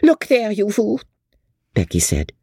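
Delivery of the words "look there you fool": provoking good humour. A narrator's reading. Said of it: "with provoking good humour".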